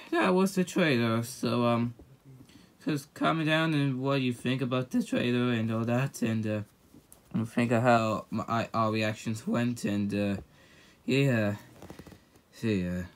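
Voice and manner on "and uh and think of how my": usual speaking voice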